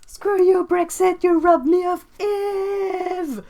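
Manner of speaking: loud, high, slow dramatic wail; mock outrage. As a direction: shake a fist at the sky in melodrama.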